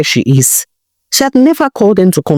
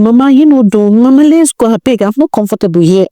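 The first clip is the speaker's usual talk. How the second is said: higher-pitched, whining plea to leave; impatient complaint; 7 semitones higher than usual. A narrator's reading. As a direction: sisters pestering their mother to get out. Sisters urging mother to leave.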